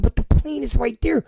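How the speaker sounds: high-pitched, insistent begging; urgent pleading. Retold or imitated. Passenger begging agent to reopen plane, urgent pleading. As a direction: plead until you get cut off.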